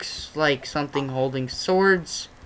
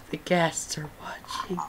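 whisper; 8 dB quieter than usual. Real, not acted. Spooky whisper in dark nether.

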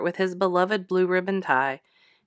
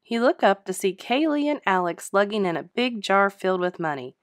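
ordinary delivery, nothing marked

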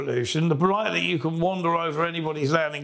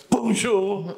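pointed bonjour; 5 semitones higher than usual. Retold or imitated. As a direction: correct them with a mocking "Bonjour,". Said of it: "they go".

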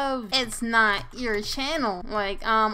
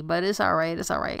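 normal talking voice

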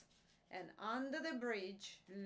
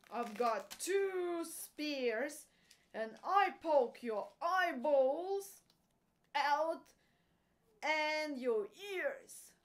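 loud, higher-pitched, defiant goat; big goat's challenge; 4 semitones higher, 9 dB louder than usual. A narrator's reading. Big billy goat daring the troll to fight, loud defiance. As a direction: dare the troll on, punching the words out. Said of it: "what the big billy goat said".

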